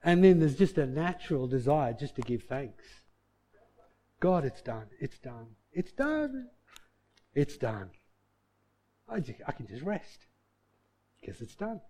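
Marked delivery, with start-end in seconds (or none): 4.2-11.8 soft prayer, repeated with pauses; acted quiet wonder, letting go into rest; hushed thankful relief. Believer gives thanks to God, hushed and at rest.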